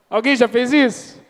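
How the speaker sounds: ordinary talk, nothing marked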